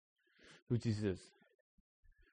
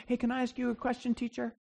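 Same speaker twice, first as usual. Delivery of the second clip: offhand question; 6 semitones higher, 8 dB quieter than usual. Retold or imitated. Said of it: "he doesn't just ask him a question".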